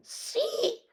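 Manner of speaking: very high squeaky voice; small dog voice. A narrator's reading. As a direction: pipe it up high. Poquito voice again.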